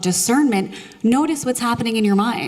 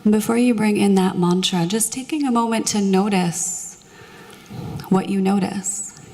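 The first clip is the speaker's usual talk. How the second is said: soothing meditation voice; 2 semitones lower than usual. Acted. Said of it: "my very soothing meditation voice".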